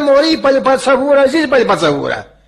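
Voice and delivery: high, loud quarrelling voice; acted outrage. Insulted believer refuses to let it go, acted outrage. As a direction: rant like an insulted neighbour.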